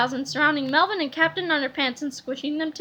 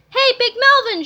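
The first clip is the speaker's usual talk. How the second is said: higher, loud call; shout; 8 semitones higher, 7 dB louder than usual. A narrator's reading. George calling to Melvin, loud shout. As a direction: call out across the scene. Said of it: "shouted George".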